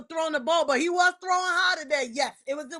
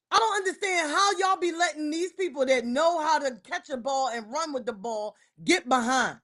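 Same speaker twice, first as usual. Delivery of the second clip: ordinary talk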